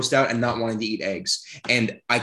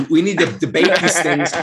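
louder, higher, mock-heated push; mock mad; 4 semitones higher, 6 dB louder than usual. Real, not acted. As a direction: raise it like a fake-angry debater. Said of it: "Now I'm getting mad".

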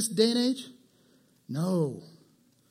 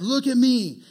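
bragging; 5 semitones higher, 6 dB louder, slower than usual. Retold or imitated. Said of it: "He's like, he's like".

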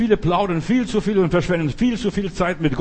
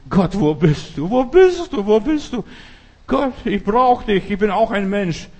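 loud, high, desperate cry to God; pleading prayer; 4 semitones higher, 4 dB louder than usual. Acted. Hannah crying to God in her prayer, pleading loudly. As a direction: cry out to God, voice climbing and pressing. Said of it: "lies mal ihr Gebet".

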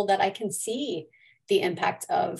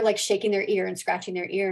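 ordinary talk, nothing marked

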